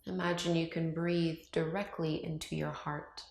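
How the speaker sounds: calm meditation voice with long, unhurried pauses; guided meditation. Acted. Yoga teacher guiding viewers to open up to love, calm meditation voice. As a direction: ease them through each breath, leaving silence between phrases.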